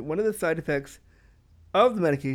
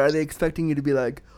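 ordinary talk

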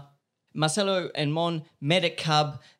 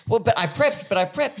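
higher, louder mock fretting, repeating prepped; hammed-up nervous guest pleading she prepped; mock anxious insisting; 8 semitones higher, 6 dB louder than usual. Host mimicking nervous guest, higher and louder.

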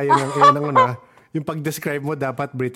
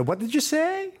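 ghost voice; slower than usual. Retold or imitated. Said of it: "Hindi sila".

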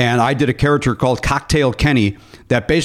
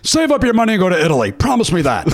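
put-on higher, fast old-man advice; lightly voiced Ken nagging; Ken Severa impression; 6 semitones higher than usual. Host imitates Ken Severa urging a trip to Italy. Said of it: "Ken Severa once".

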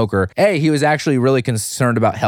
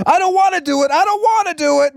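put-on much higher, whining like a reluctant kid; mock whiny refusal. Host refusing the grim scene in a high whine. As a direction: whimper it out like a scared child.

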